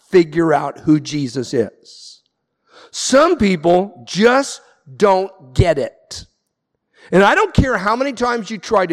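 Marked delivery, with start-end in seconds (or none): 2.9-6.3 slow, weighty statement; slow and deliberate. Real, not acted. Pastor to congregation introducing his theme, slow and weighty. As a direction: lay each word down slowly.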